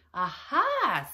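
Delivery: high, loud burst of triumph; gleeful cry of discovery; triumphant exclamation. A narrator's reading. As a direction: crow it with delight. Anthony's spell works, he exclaims.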